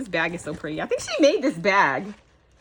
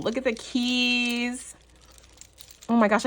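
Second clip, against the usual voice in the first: usual speaking voice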